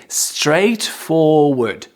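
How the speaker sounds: very slow, stretched STRAIGHTFORWARD; slow careful pronunciation. Shown as a demonstration. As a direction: model a hard word syllable by syllable. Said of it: "It's hard to say".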